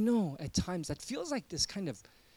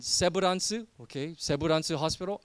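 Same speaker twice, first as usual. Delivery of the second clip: "Seburansu" said the Korean way, syllable by syllable; Korean-accented hospital name, "Seburansu" with a rising end; 4 dB louder than usual. Shown as a demonstration. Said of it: "I'll kinda say it in a Korean accent".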